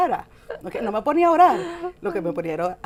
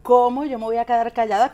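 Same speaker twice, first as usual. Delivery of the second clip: louder, insistent retold objection; retold indignant protest; 3 semitones higher, 7 dB louder than usual. Wife recalls objecting to pastor's advice, indignant protest. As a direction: push back in a raised voice, re-enacting it. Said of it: "yo recuerdo que yo decía".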